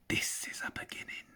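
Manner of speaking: breathy secret whisper to himself; hushed, pleased with his theft; whispered thought. Acted. Hobbit whispers his thought to himself, hushed.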